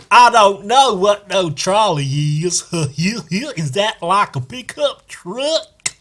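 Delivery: high, slow laughing mock American; dumb American. Mocking American viewers, high slow voice with laughs. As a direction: ham it up through laughter. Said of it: "That's how Americans talk".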